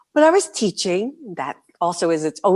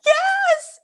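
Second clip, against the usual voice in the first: much higher, louder excited yes; delighted yes; 4 dB louder than usual. Real, not acted. Speaker confirming to host, thrilled. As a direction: shout your delight.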